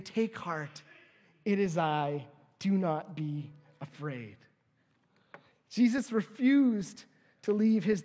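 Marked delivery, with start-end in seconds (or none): 0.0-4.4 slow, gentle calming words; gentle and slow, a calm comfort to the disciples; reassuring. Acted. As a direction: soothe frightened friends slowly. Jesus calms frightened disciples.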